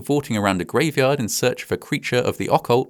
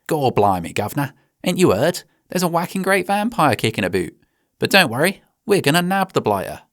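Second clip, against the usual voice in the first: higher, hammed-up Cockney urchin accent; cheeky East End Cockney street kid; old london cockney; 4 semitones higher than usual. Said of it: "reply something along the lines of".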